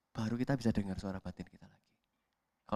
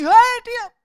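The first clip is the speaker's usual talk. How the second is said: much higher and louder, a sharp cry; inner voice shouting; 18 dB louder than usual. Acted. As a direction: shout it as the conscience fighting back. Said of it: "Nggak lagi".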